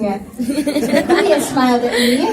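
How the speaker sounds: narrating through a laugh. A narrator's reading. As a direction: let the laugh spill over.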